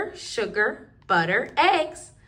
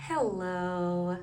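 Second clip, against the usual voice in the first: teacher voice; 8 semitones lower, 8 dB quieter than usual. Pretend. Teacher's greeting in the game.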